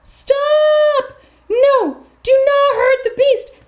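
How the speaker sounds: high, drawn-out pleas to stop; storyteller's contained scream of protest; mock scream. A narrator's reading. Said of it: "she screams".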